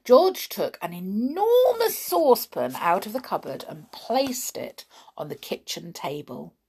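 ordinary delivery

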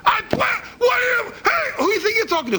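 high-pitched, sputtering angry shout; hammed-up furious yelling; acted angry outburst. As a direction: yell in outrage, tripping over the first words. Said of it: "You understand when you go".

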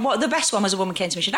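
ordinary talk

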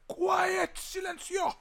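loud high teacher's shout. Retold or imitated. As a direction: bellow it like a strict teacher. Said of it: "un maestro irlandés que gritó la palabra". (grito de maestro)

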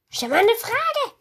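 high, slow begging; pleading. Real, not acted. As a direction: beg them to ask. Demanding fans ask. (hoch und flehend)

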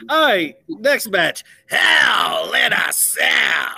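slow drawn-out raspy ring announcer shout; Vince McMahon announcer. Retold or imitated. Host announcing the next match to cohosts, slow raspy shout. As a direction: boom out the match like the WWE boss. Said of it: "You like the Vince McMahon raspiness on that one?".

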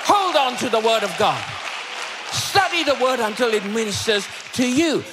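high, punchy exhortation; forceful preaching. Real, not acted. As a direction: drive it home to the congregation.

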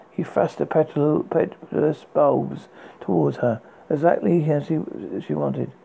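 plain reading voice

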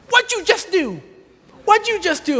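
high, alarmed questioning; startled woman. Retold or imitated. Startled blind woman questions him.

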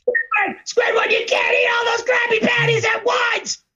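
high shouted cartoon warning; SpongeBob voice. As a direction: warn him like an excited sponge.

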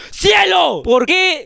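high, sharp call of a name. Real, not acted. Host calling Cielo to answer, high shout.

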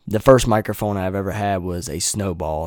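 usual speaking voice

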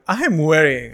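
a teenager fretting aloud, retold; anxious insistence, lightly acted; worried protest. Retells own worried protest to mom.

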